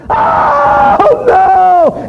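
shrieking high and loud; blood-curdling scream. A narrator's reading. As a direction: let out a horrified scream. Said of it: "this blood-curdling scream".

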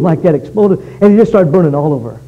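ordinary talk

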